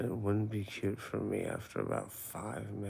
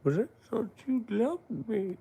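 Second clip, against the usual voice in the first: high, loud, wounded plea; hammed-up heartbroken protest; mock hurt; 12 semitones higher, 8 dB louder than usual. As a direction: play betrayed in a high put-on voice. Mock hurt at being reported.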